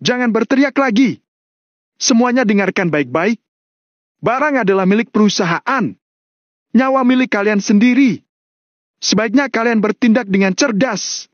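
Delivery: high-pitched stern order; commanding. Acted. Kasman warns the screaming employees, commanding. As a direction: order the room in a high, forceful voice.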